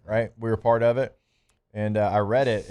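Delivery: ordinary delivery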